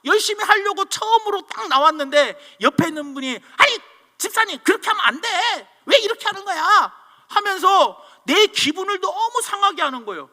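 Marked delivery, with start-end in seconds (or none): 3.6-6.9 high-pitched mock scolding; imitated nagging criticism. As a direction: object like a meddling church colleague. Critical church member voiced in anecdote.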